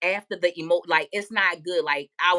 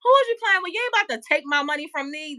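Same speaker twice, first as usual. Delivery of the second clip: mock confrontation; 9 semitones higher than usual. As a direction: call her out for the whole store to hear.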